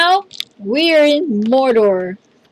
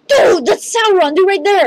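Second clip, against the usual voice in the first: gasping, high and louder, thrilled; excited gasp; 7 semitones higher, 3 dB louder than usual. Real, not acted. Gamer spotting Sauron to his friend, gasping high excitement. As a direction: blurt it out as you spot him.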